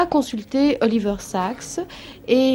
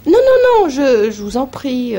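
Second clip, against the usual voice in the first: protesting patient; 2 semitones higher, 7 dB louder than usual. Retold or imitated. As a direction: blurt out a startled no, no.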